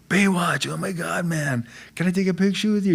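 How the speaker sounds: high-pitched excited fan impression; starstruck fan. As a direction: imitate a thrilled fan, pitched well up. Customs guy recognizing him, retold.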